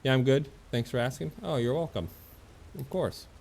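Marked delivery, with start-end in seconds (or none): none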